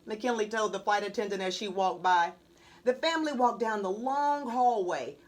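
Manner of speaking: usual speaking voice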